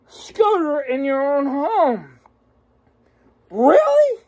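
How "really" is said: mock disbelief. Real, not acted. Incredulous at scooter charging.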